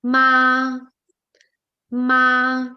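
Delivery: "ma" held long on one steady level tone; first tone. Shown as a demonstration. Teacher shows student the first tone, constant "ma". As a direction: say "ma" drawn out, pitch constant. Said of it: "ma in constant, that is first tone".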